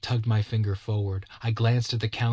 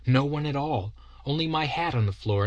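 usual speaking voice

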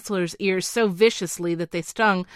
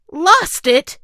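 higher, louder shocked outcry; astonished and angry cry; 9 semitones higher, 10 dB louder than usual. Acted. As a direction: gasp it out, stunned and cross. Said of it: "cried the king in mingled astonishment and anger".

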